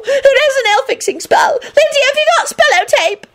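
very high, frantic squeal; girlish scream. A narrator's reading. Redhead begging friends to fix nail, screaming. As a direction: shriek for urgent help. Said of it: "screamed the redhead girl".

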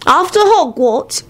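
put-on slow, high child's voice; little boy. A narrator's reading. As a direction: begin slowly as a small child. Child tells his tale.

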